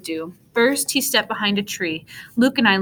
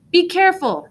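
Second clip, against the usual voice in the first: high, loud call of warning; father's warning shout; 10 semitones higher, 5 dB louder than usual. A narrator's reading. Father shouting to his tumbling son, loud and high. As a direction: shout it as he trips. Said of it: "my father shouted".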